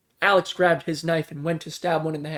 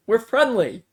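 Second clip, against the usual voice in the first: higher, louder reassuring yell; 6 semitones higher, 5 dB louder than usual. A narrator's reading. Stranger assuring the group from afar, yelled. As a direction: shout it across to them. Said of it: "yelled another".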